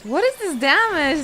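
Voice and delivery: loud, high exclamation; outraged disbelief. Real, not acted. Gamer reacting to damage taken, outraged shout. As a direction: burst out at an unfair hit.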